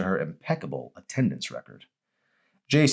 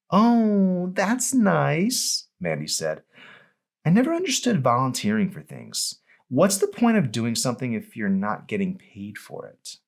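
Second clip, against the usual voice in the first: put-on much higher, airy, condescending girl voice; dismissive Mandy; 8 semitones higher than usual. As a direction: brush her off sweetly.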